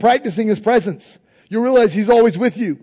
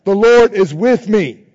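louder each time, leading the crowd; loud declaration; 7 dB louder, slower than usual. Shown as a demonstration. Preacher leading congregation in chant, loud declaration. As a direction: call it out for all to repeat. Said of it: "Try it".

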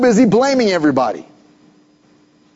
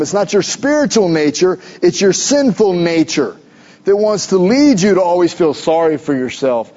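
ordinary delivery

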